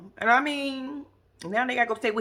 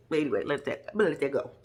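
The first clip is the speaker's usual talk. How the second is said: ordinary talk, nothing marked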